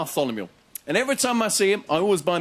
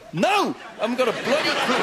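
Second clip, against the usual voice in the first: exasperated; 6 semitones higher than usual. Retold or imitated. Exasperated reply to kid.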